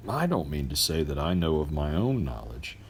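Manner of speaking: plain reading voice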